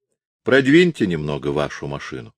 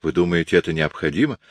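ordinary delivery

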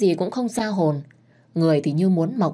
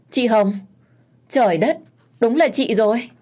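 higher, louder burst of delight; overjoyed greeting; 5 semitones higher, 4 dB louder than usual. A narrator's reading. Bride-to-be spotting her long-missed friend, overjoyed. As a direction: exclaim in happy disbelief. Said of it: "tôi mừng như điên".

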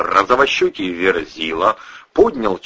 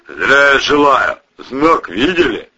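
booming bass; 5 semitones higher, 5 dB louder than usual. Acted. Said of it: "раскатистым басом".

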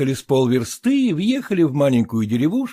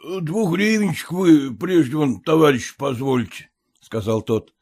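ordinary delivery

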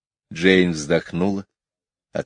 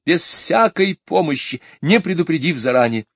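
high, loud aggrieved complaint; wounded lady's pride; high huffy mother; offended mother; 7 semitones higher, 5 dB louder than usual. A narrator's reading.

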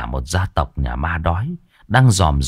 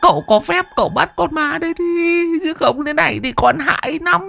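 much higher, pleading wail; panicked begging. Acted. Old man begs the master to catch the ghost, high panic.

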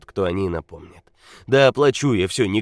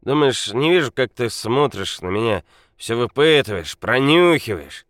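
put-on loud drunk rant; 5 semitones higher, 7 dB louder than usual. A narrator's reading. Drunk Nikola confides loudly.

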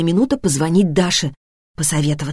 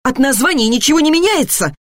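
high-pitched, impatient retort; irritated mother correcting her son; 8 semitones higher than usual. A narrator's reading. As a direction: insist crossly, pitch jumping up. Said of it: "раздражённо проговорила Алёна".

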